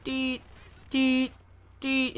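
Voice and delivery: high beeping imitation; heart monitor beep. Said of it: "It's like".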